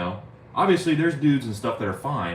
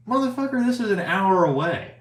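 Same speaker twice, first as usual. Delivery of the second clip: much higher and louder exasperated cry; incredulous outburst; 11 semitones higher, 4 dB louder than usual. Retold or imitated. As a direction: yell it in disbelief at the buyer. Retold outrage at buyer.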